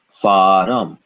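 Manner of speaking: farum said with an uh between r and m, fa-rum; farum with extra vowel. Shown as a demonstration. Teacher models farum to students, fa-rum. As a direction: say farum in two beats, r then uh then m. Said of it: "how would we pronounce this".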